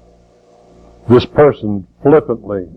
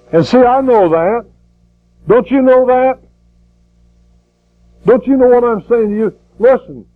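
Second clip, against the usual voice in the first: revival preacher reading Paul's words; preaching at full force; 6 semitones higher than usual. Acted. Climactic preaching and scripture recital.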